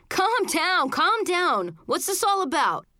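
intervening adult. A narrator's reading. Uncle breaking up children's fight.

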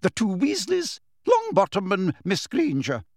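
high-pitched, crisp professor; brisk professor. A narrator's reading. Professor awarding house points to students, briskly. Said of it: "Professor McGonagall continued briskly".

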